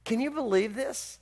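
usual speaking voice

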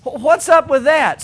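lightly voiced bewildered Moses; whiny Moses. Moses asking God why him, mildly whining. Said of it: "is standing there going like".

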